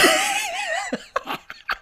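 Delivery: long high breathy laugh; high-pitched laugh. Real, not acted. Co-host laughing.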